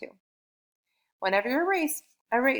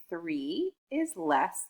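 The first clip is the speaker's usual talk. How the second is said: slow, word by word answer; slow model answer; much slower than usual. Shown as a demonstration. Teacher modelling the answer for a child, slow and clear. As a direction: model the answer sentence slowly. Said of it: "three is the smaller number".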